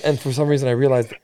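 usual speaking voice

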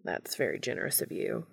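low, soft, dry retort; understated and drily polite. A narrator's reading. Castiel answers Dean's brush-off, dryly. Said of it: "Castiel says dryly".

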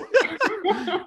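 high burst of laughter; real amused laugh. Real, not acted. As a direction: laugh hard at the idea. Laughing at the story.

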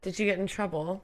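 put-on light borrowed English accent; slipping into English accent. Host questioning English caller, catching his English accent. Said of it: "literally sounding like you".

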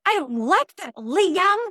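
put-on small child standing his ground; imitating a toddler. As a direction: play the three-year-old telling off his big brother. Toddler voiced scolding brother.

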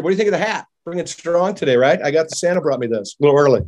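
usual speaking voice

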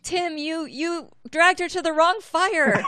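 loud, high-pitched mock outrage; mock accusation. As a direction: call out a friend's blunder, drawing it out loudly. Teasing fellow player over game mistake.

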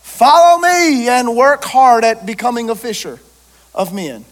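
loud, ringing proclamation of the call; proclaimed quote. Acted. Preacher proclaiming Jesus' call to the crowd, loud and ringing.

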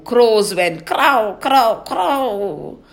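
loud, slow cawing; crow caw. Acted. Crow calls for children.

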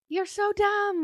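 high-pitched scornful jab; acted high-voiced insult; mock exasperation. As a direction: imitate her exasperation in a very high voice. Retold insult in bathroom quarrel.

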